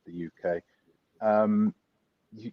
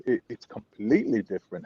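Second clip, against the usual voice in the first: normal talking voice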